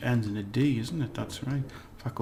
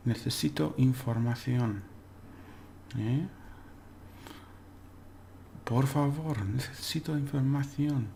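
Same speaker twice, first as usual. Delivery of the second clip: ordinary talk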